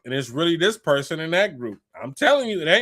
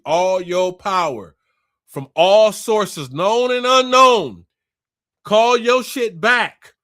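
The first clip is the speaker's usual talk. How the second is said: louder and slower with each phrase, known and unknown hammered; slow building preach; 6 dB louder, slower than usual. Real, not acted. To viewers, rising into a forceful slow preach. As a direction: preach it out slowly, swelling up. Preaching, calling back energy.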